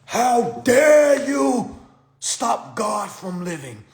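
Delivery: high, drawn-out theatrical outrage; Harry Potter character. Pretend. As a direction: proclaim it slowly like an offended fantasy noble. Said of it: "talk like somebody from Harry Potter".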